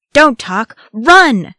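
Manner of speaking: loud, high shouted command; shouting. A narrator's reading. Aragorn drives the Fellowship on through orcs, shouting. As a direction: shout it, hardest on the last word. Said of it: "shouted Aragorn".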